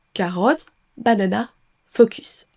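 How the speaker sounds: "carotte, banana, focus" in French vowels; spelling pronunciation. Shown as a demonstration. Teacher imitates learners reading letters, French-style pronunciation. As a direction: read each letter fully, French-style, no schwa. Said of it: "tendance à remplacer le son E".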